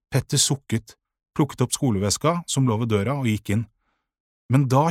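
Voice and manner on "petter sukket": ordinary delivery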